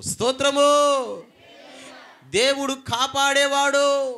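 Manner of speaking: slow, loud, high praise cry; shouted praise. Real, not acted. Preacher leading praise, slow loud cry. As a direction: ring it out slowly to the back wall.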